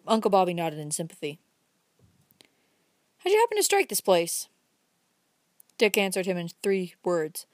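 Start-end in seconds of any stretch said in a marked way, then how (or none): 3.2-4.5 much higher, louder folksy question; pry with a lifted old-timer's voice; curious old man. Acted. Old man questioning the stranger, high-pitched and louder.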